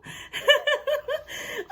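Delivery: high, giggly laugh. Real, not acted. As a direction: laugh in delight after the game. Amused after buffalo leaves.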